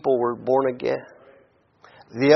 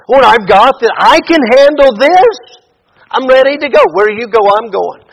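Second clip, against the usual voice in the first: cocky, much higher and louder bravado; boastful Peter; 5 semitones higher, 7 dB louder than usual. Retold or imitated. Preacher mocking Peter's boast to Jesus, cocky and loud. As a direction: puff up with overconfident swagger. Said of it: "What does he say?".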